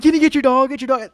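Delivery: high, urgent acted plea; panicked pleading. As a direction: call for help in mock panic. Repeats plea to the kid.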